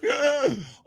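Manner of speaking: sharp high gasp of panic; panicked gasp. Retold or imitated. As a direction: suck in air in sudden panic. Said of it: "And I was like".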